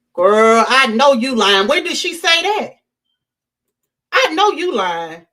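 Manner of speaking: calling out a liar. Real, not acted. Calling out a commenter.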